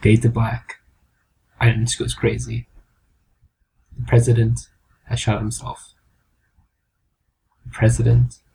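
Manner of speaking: low, grave movie-trailer narration; understated dramatic announcer; mock film ending. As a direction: close it out like a solemn film.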